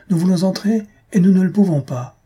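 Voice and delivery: loud, high and insistent; indignant shout. A narrator's reading. Old aunt demanding entry from the waiter: loud, high shout. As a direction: demand it loudly over the crowd. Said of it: "cria la vieille demoiselle".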